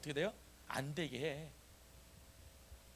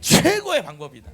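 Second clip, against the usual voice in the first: very loud shout on 최고의; forceful repeat-after-me; 14 dB louder than usual. Shown as a demonstration. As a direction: belt out 최고의, then drive through to the end. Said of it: "다시". (최고의를 크게 외치며 선창)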